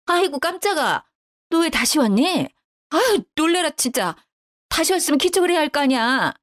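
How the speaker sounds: high, flustered mother-in-law; jump, then scold her for sneaking up; startled scolding. Acted.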